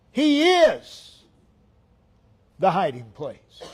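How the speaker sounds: "is" pitched high and held; stress on IS. Real, not acted. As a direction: ring out "is" each time, then fall.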